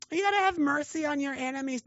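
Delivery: high, mocking sing-song; high mocking do-gooder voice. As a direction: parody the naive do-gooder. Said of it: "mocking opposing view".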